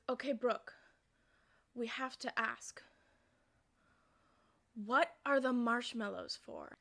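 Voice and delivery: baffled dad, spacing out each phrase; slow, puzzled question. Retold or imitated. Dad comes out to ask about the food, slow and puzzled. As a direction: ask it slowly and deliberately.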